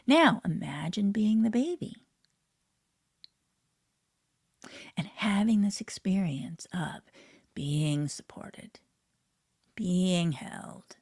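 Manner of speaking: slow, half-whispered guidance; hushed guided imagery; guided meditation. Acted. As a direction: soothe the listener into feeling held.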